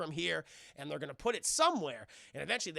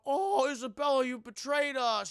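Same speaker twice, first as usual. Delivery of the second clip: imitating Jackie Chan; 7 semitones higher, 7 dB louder than usual. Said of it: "Jackie Chan is just like".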